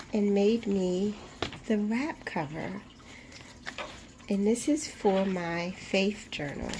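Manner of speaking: ordinary talk, nothing marked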